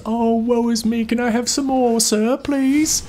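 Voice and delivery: very high, pleading waif voice; Dickensian orphan. Pretend. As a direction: beg like a starving orphan. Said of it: "Dickensian orphan just left out in the cold".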